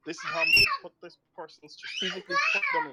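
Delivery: sudden high excited yell; excited shout. Real, not acted. Explaining the genius of the memes, excited yell. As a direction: burst out in excitement.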